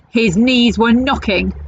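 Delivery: plain reading voice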